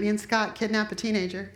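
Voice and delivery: ordinary talk, nothing marked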